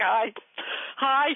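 mock-embarrassed little hellos; awkward, halting hi; sheepish greeting. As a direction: caught out and greeting the neighbors. Mimics sheepish greeting to neighbors.

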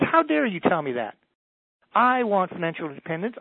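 a hammed-up affronted protest; indignant retort. Woman on stage snapping back at the speaker, mock indignant. Woman stomps foot, argues.

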